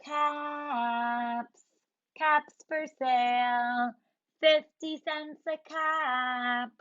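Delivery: slow sing-song street call; peddler calling. Acted. Peddler walking to town calling his caps, slow. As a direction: call out wares while strolling.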